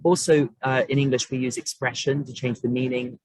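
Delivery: normal talking voice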